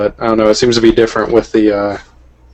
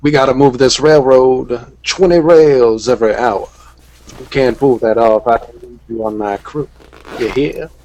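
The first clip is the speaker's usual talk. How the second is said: western railroad boss; 2 semitones higher than usual. Retold or imitated. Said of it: "over-the-top Hell on Wheels character".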